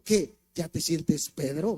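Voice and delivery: low, slow sneering question; mocking inner voice; mocking taunt. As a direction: tease him for feeling like Peter. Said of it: "escuché una voz que me dijo".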